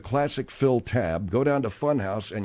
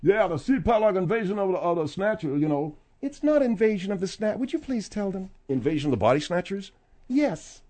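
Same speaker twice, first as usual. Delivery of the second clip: higher squeaky mocking voice; 7 semitones higher than usual. Host mocks the sidekick's movie title, high squeaky voice. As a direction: play a silly know-it-all.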